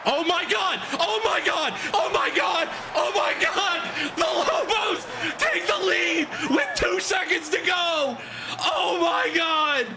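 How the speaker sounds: shrieking with pure joy; ecstatic screaming. Real, not acted. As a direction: lose it completely over the winning score. Game-winning touchdown live reaction.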